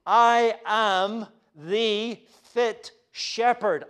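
slow, drawn out, weight on fit; slow solemn proclamation; emphatic. Shown as a demonstration. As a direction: say fit slowly and firmly. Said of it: "Jesus says".

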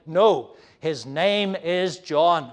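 loud NO, then slow, firm "his name is John"; emphatic refusal. Acted. Elizabeth answering the relatives, emphatic refusal. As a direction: put your foot down, each word weighted. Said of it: "a sense of her stomping her foot".

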